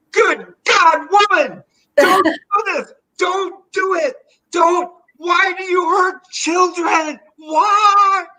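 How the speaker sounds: very high, loud sobbing shout; hysterical crying plea. Retold or imitated. Terrified child screaming at the nurse not to take blood. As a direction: wail it, begging, like a melodramatic child. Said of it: "a scene in the office".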